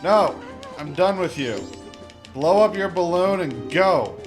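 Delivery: ordinary talk, nothing marked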